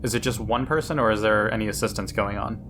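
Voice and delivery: ordinary delivery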